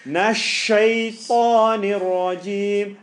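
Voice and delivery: slow, loud chanted "Nash shaitanir rajim"; Quranic recitation. Shown as a demonstration. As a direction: recite it with a heavy sh. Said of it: "Sheen is always heavy".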